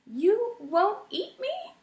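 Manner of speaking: high, small mouse voice, scared; a timid mouse answering the porcupine; frightened little mouse. A narrator's reading. Poppy hopeful, unsure.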